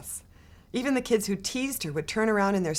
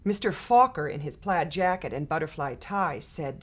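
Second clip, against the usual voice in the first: usual speaking voice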